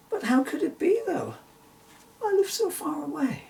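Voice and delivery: put-on high, pleading little girl voice; a child fretting about distance; girlish worry.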